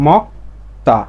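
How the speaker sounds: মক্তা split into "mok" and "ta", with a voiceless t; corrected t reading. Shown as a demonstration. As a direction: model মক্তা syllable by syllable, t not d. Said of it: "তাহলে এর উচ্চারণটা হবে".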